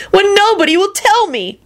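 very high, plaintive character whine; piteous wail. A narrator's reading. Diner laments that nobody teaches him, wailing. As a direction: plead in a thin high wail. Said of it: "Kenny wailed piteously".

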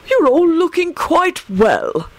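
high, slow genial compliment; beaming, leisurely host; welcoming headmaster. A narrator's reading. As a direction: draw it out pleasantly, pitched high. Headmaster beams at battered visitors.

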